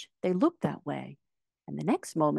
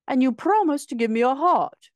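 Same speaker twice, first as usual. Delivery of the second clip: higher, louder metallic-man voice; Tin Woodman's voice; 9 semitones higher, 7 dB louder than usual. A narrator's reading. Tin Woodman demanding his heart, higher and louder. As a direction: stake the claim for a heart. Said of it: "said the Tin Woodman".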